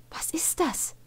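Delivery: high breathy whisper; a hushed, curious breath. A narrator's reading. Farah eyes the new book, whispered. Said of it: "hauchte Farah".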